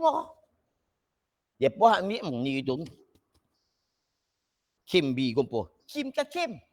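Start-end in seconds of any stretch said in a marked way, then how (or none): 5.9-6.6 high-pitched query on "Kim"; insistent questioning. Retold or imitated. Pupil asking which word is right, high insistent questioning. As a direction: act out the pupil double-checking, voice raised in pitch. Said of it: "Apa tanya?".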